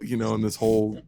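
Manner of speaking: usual speaking voice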